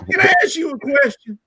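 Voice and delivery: sharp high call-out; rising challenge aimed at Moss. Real, not acted. Coach calling out Randy Moss, high and sharp.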